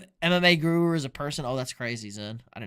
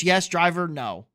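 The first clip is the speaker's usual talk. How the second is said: normal talking voice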